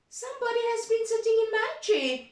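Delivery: middle-sized bear voice. Acted. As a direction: squeak it up as mother bear. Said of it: "in her middle-sized voice".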